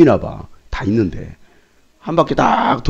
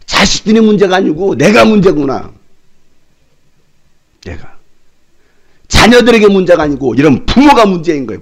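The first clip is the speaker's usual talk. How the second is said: preaching climax; 5 semitones higher, 7 dB louder than usual. Real, not acted.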